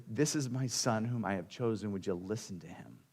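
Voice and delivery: usual speaking voice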